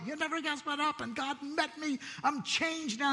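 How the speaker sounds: breathless eager telling; retold excitement. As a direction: gush like a man who just met God. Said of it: "I'm just so excited".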